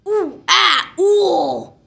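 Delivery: put-on a boy squealing while splashing; squealing little boy; boy crying out. Boy struggling in water.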